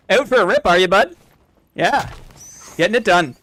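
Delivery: put-on loud small-town Canadian accent; towny Canadian accent. Streamer playing a townie greeting a bud, Canadian accent. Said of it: "Love the towny Canadian accent".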